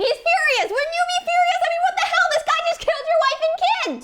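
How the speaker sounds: high, loud indignant yelling; outraged narrator ranting about the murders; furious outburst. Acted. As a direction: rage on his behalf. Outrage at Macduff's loss.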